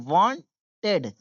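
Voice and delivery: ordinary talk, nothing marked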